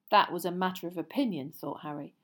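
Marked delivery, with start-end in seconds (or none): none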